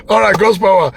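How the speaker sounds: ordinary delivery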